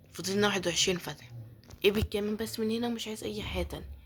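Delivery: normal talking voice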